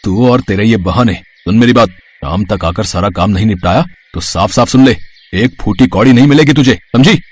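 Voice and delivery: low, loud, scolding male voice; threatening boss. Acted. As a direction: threaten her as a harsh employer, punching the ends. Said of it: "वो आदमी रसीदा को धमकाकर". (धमकाता हुआ मालिक)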